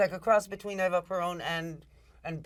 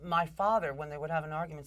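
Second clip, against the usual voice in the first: usual speaking voice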